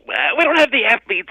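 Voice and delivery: high, loud, excitable radio host; Mad Dog voice. Retold or imitated. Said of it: "Mad Dog goes".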